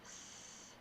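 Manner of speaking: S hissed alone, unvoiced; isolated S sound. Shown as a demonstration. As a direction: hiss the S sound by itself. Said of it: "tap out the sounds we hear".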